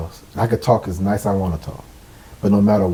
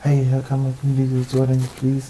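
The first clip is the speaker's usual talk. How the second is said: mock polite ask; 6 dB quieter than usual. Mocking overly polite request.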